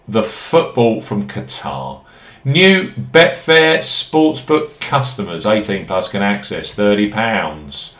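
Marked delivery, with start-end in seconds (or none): 2.4-5.5 hyped betting ad. Acted. Hyped promo read.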